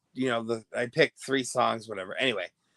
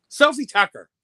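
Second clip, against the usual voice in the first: much higher and louder, a name suddenly remembered; excited realization; 13 semitones higher, 11 dB louder than usual. Real, not acted. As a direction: blurt it out in triumph.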